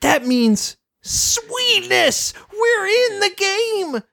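high, thrilled cries; excited shout. Retold or imitated. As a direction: squeal with delight at the game. Spike thrilled in the game.

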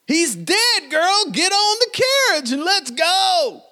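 high falsetto urgent order; Margaret urging. Acted. Margaret tells the grieving girl to get aboard, high urgent falsetto. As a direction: hurry her onto the carriage.

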